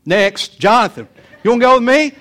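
re-enacted holler, high and loud; calling out. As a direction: call the next kid over. Re-enacting calling the next kid.

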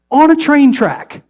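exclaimed slowly; incredulous punch. Real, not acted. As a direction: land the punchline with disbelief. Punchline answer.

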